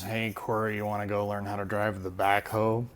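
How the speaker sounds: lightly voiced drunk stepdad, a touch low; a hint of a boozy dad's invitation; tipsy stepdad. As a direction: imitate a slightly drunk stepdad. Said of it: "he's a little drunk".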